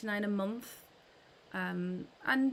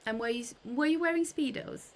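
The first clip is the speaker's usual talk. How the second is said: ordinary talk, nothing marked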